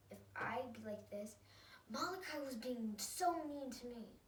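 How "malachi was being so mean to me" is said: louder, higher, drawn-out complaint; demonstrated yell. Shown as a demonstration. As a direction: wail it slowly as a mock tattletale yell. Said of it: "yelling and I say".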